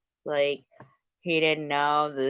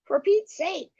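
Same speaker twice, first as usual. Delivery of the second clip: 1 mock exasperation. Exasperated pun exclamation.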